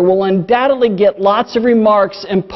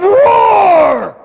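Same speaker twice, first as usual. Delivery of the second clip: high, drawn-out mock lion roar; 8 semitones higher, 3 dB louder than usual. Preacher voicing the lion pouncing on the man, roaring. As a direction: let out a hammed-up roar like a lion. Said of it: "The lion pounced up and went".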